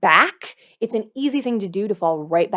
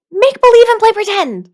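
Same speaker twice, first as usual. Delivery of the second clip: much higher and louder, gleeful; excited cheer; 12 semitones higher, 9 dB louder than usual. Real, not acted. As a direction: burst out with delight. Enthusiastic exclaim.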